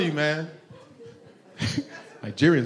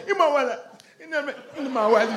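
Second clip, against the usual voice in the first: higher, softening mock African babble; African accent; 8 semitones higher, 4 dB quieter than usual. African man interviewed on the news, mock African accent. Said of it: "always happens when Africans are talking".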